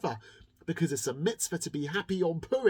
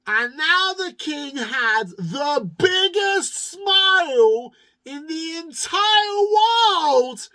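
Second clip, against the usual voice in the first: louder, higher, very slow stretched words; grand storyteller build; 12 semitones higher, 12 dB louder, much slower than usual. A narrator's reading. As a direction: stretch it out big and booming.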